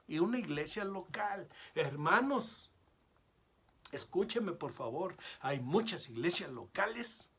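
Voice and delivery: loud, high, impassioned sermon climax; a pastor thundering at his congregation; fiery preaching. Acted. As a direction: preach it full force, calling the church to repent. Climactic preaching at full force.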